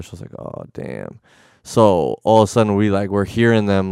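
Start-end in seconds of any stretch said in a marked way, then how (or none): 0.4-1.2 low, soft sinking groan of words; dismayed aside. Retold or imitated. Hearing more cops are coming, low dismay. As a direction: let it drop low and quiet, deflated.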